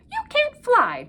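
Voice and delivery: high, bright little bird chirp; twittering bird. A narrator's reading. Bird twittering at the duck, high and chirpy. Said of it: "twittered the bird".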